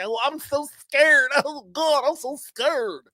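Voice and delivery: high, slow whiny mock fear; mock him as a scared baby; mocking scared Cody. Mocking Cody's covid fear, slow and whiny. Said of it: "how he was scared he was gonna die".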